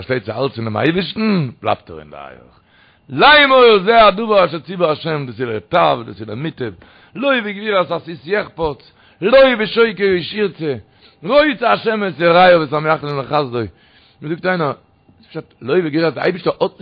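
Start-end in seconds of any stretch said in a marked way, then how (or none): 3.1-13.7 loud, high attack on each phrase; preaching. Acted. Preacher reciting a verse to his listeners, forceful declamation.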